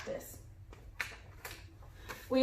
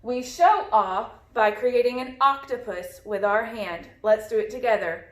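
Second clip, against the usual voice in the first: ordinary talk, nothing marked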